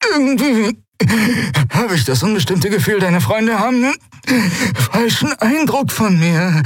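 choked, high strangled pirate voice; rasping Sephiron. Acted. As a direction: squeeze it out with a hand at the throat. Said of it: "röchelte Sephiron".

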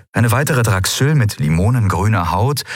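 usual speaking voice

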